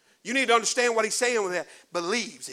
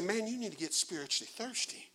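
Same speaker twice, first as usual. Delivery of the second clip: quiet prompted line; 3 semitones lower, 15 dB quieter than usual. Shown as a demonstration. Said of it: "Look at your neighbor and say".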